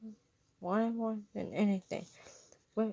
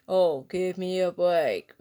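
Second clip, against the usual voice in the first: muttered; 3 semitones lower, 6 dB louder, slower than usual. A narrator's reading. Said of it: "muttered under her breath".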